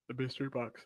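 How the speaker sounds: mid-yawn, words stretched. Real, not acted. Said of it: "I didn't mean to yawn".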